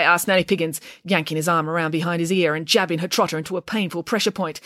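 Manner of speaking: plain reading voice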